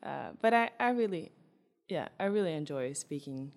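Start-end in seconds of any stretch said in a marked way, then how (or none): none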